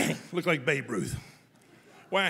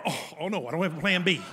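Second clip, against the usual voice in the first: usual speaking voice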